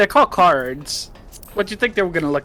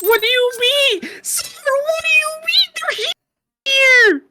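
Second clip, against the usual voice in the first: high squeaky voice, much louder; 12 semitones higher, 10 dB louder than usual. Pretend. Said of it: "What's with the squeaky voice?".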